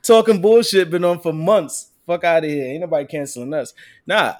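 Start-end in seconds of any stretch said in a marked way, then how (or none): 0.0-2.6 laughing boast. Real, not acted. Boasting his show lasted months, loud and laughing. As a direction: gloat while laughing.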